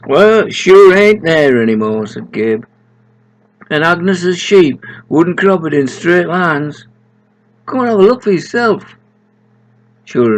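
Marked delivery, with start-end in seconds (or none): 0.1-2.1 higher, louder complaint; loud indignant Gabe; louder protest. Acted. 3.7-9.0 indignant then hurrying them along; high excited character voice; high Gabe voice. Acted.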